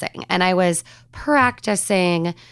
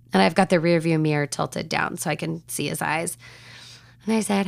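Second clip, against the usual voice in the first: ordinary talk, nothing marked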